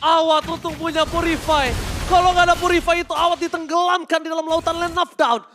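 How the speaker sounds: high, loud excited commentary. Real, not acted. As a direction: ride the hype of the save. Caster reacts to a save.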